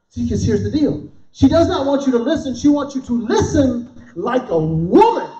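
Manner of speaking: usual speaking voice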